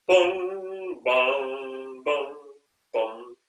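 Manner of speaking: descending tolled booms; tubular bells. Shown as a demonstration. Conductor imitating bells for basses, descending chimes. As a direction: chime slowly down, each note ringing. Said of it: "like tubular bells".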